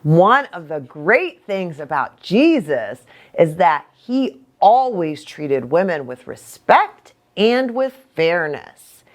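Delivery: ordinary talk, nothing marked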